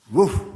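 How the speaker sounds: sharp, high barked woof; loud dog woof. Shown as a demonstration. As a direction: bark like a playful dog. Imitating dog for children.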